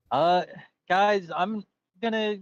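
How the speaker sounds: high, bright put-on character voice. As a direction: play the figure high and lively. Character voice, S1 plays a figure.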